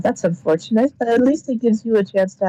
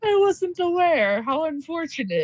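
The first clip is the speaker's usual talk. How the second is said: higher, feigned innocent surprise; higher voice, pretending not to know; mock surprise; 10 semitones higher than usual. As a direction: play it as if genuinely shocked. Estrella feigns shock at gossip.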